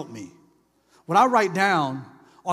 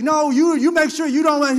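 louder, higher scolding boss; boss going off; 8 semitones higher, 7 dB louder than usual. Retold or imitated. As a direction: chew out a late worker. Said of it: "he's all in my face and going off".

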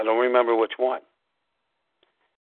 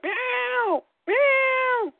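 high cat meowing, much higher than the speaker's voice. Retold or imitated. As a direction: imitate a cat meowing straight at you. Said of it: "she's like".

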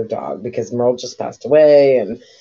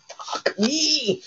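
high, despairing groan; exasperated; 14 semitones higher, 5 dB quieter than usual. Retold or imitated. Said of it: "I'm like".

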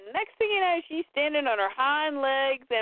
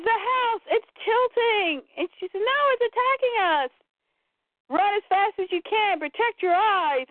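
hammed-up fright, pitched up and stretched; acted alarm; 8 semitones higher than usual. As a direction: cry out in comic-book alarm. Acted alarm in collapsing house.

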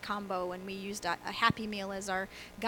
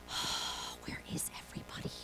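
whisper; 11 dB quieter than usual. Pretend. Pastor seeing low attendance.

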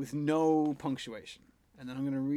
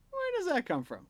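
wondering aloud, pitched up; curious wondering. Retold or imitated. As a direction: quizzical muse. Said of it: "seeing a weird word and going".

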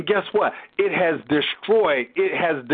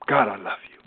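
mock churchy piety; 9 semitones lower, faster than usual. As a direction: ham up a fake holy tone for show. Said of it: "it sounds churchy".

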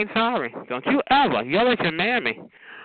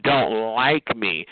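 slow mocking quote; 6 semitones lower, much slower than usual. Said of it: "I can live with that".

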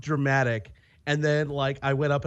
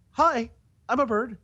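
bird-like voice; 7 semitones higher than usual. Retold or imitated. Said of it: "you were trying to do this, like".

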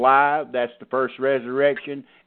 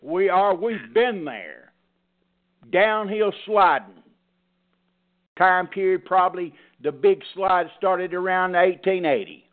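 higher, emphatic pulpit preaching; 7 semitones higher than usual. Acted. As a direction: drive the point home to the flock. Pastor preaching at congregation.

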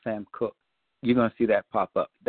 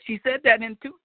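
ordinary talk, nothing marked

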